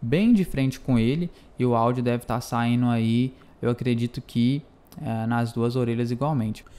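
close-miked, right at the mouth; close to the mic. Real, not acted. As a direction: lean in close to the microphone. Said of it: "vou aproximar o microfone mais aqui da minha boca".

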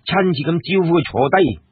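plain reading voice

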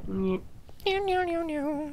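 high squeaky meowing; cat meow. Pretend. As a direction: meow like a kitten. Imitates a cat.

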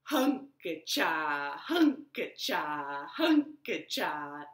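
slow rhythmic chop sound; sawing chant. Shown as a demonstration. Storyteller makes the woodcutting sound for children, slow. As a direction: chant each hunka-cha slowly like chopping. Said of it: "it went like this".

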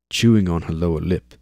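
ordinary delivery

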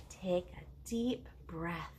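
whispered slowly, unhurried. Shown as a demonstration. Teacher guiding kids to breathe, whispered slowly. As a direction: hush them into a deep breath.

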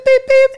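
high, loud beeping; sharp high-pitched vocal beeps; imitated phone beeps. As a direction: mimic a phone's disconnect tone. Imitates phone hang-up tones.

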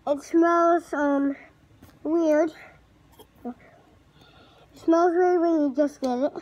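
muffled behind rubber mask; speaking into sealed respirator; through a gas mask. Real, not acted. Seen 0: Full-face gas mask with hose worn throughout the speech.

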